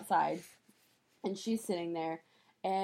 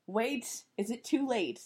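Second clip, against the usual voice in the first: urgent; 4 semitones higher, 3 dB louder, slower than usual. Retold or imitated. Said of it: "And she's like".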